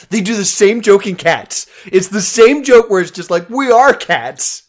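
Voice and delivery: loud, exasperated ranting. Real, not acted. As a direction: rant it out at full volume. Ranting loudly about the joke.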